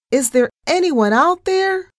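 high, loud, desperate call; screamed call. A narrator's reading. Narrator lost in thick fog on deck, screaming for anyone. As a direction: scream it out into the distance. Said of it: "I screamed again".

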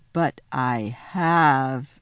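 low, very slow admission; guilty faun. A narrator's reading. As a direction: draw it out in heavy shame.

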